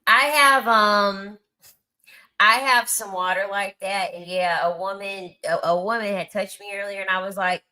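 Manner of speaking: ordinary delivery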